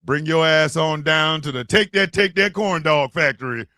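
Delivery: seduce the listener, then sell it; mock ad read. Pitching the corn dog factory, low sultry ad voice.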